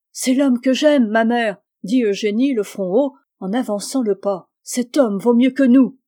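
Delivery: fille qui tient tête, plus fort; défi. Acted. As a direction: lever le front et affirmer. Eugénie défie sa mère. (Eugénie défie sa mère, voix forte)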